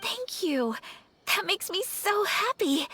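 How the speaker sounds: high, gushing happy thanks; overjoyed. Acted. Lady touched by new friends, gushing happy thanks. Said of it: "I'm forgetting myself".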